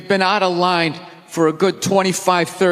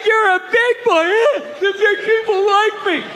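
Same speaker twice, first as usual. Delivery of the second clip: much higher, patronizing pat-on-head voice; mock praise; 11 semitones higher than usual. Comic mocking church praising a kid, high and patronizing. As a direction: talk down like praising a toddler.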